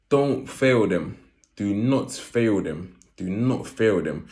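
slow, each word weighted; slow emphatic plea. Real, not acted. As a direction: stress on not in "Do not fail them". Repeated plea, slowed.

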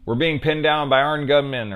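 high, loud, urgent report; hammed-up emergency call; mock panicked radio call. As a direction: shout it like a cop under fire. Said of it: "he's like".

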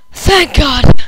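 loud burst of relief; relieved. Real, not acted. Streamer survives the night, loud relief. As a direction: blurt it out in relief.

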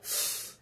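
ف breathed out as a voiceless f, no voice; just push air through the teeth for ف; teacher demonstrating that PH sounds like ف; whispered f sound. Shown as a demonstration. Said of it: "بيتنطقوا ف".

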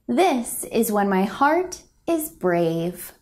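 plain reading voice

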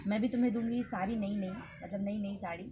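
ordinary talk, nothing marked

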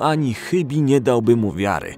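ordinary delivery

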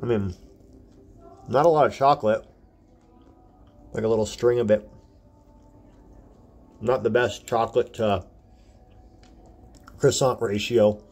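speaking between chews; chewing while talking. Real, not acted. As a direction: mouth partly full. Seen 0: bite at -7; jaw and lip chewing movements between phrases.

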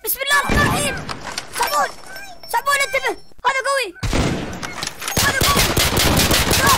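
high, loud frightened cries; panicked. Real, not acted. Gamer fighting a strong monster, high loud panicked cries. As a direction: yell in fear.

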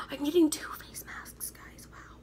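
secretive whisper to the viewers. Real, not acted. Sharing a secret with viewers, whispered.

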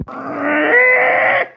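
high, loud crying yell; over-the-top wail; wailing shout. Acted. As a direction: let out a whiny shriek. Sudden outburst cry.

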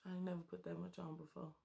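ordinary delivery

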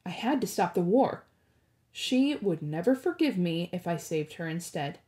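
ordinary delivery

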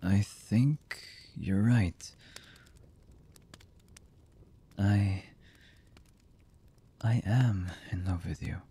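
slow, halting lover's voice with long pauses; hesitant, emotional confession. Acted. Lover confessing horrible deeds and love to the beloved, halting and emotional. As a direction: confess haltingly, one fragment at a time. Voice actor's romantic audio roleplay.

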